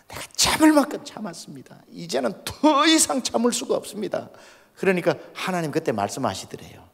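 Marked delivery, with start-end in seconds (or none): 0.1-4.3 high, strained cry to God; anguished plea. Retold or imitated. Pastor crying out to God that he cannot bear it anymore, high anguished plea. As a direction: pour out pent-up anguish, pitched high.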